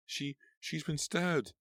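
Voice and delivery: high, slow, halting choked cry. A narrator's reading. Clown choking out the news to the crowd, high and slow. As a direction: force the words out through shock. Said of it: "managed to choke out".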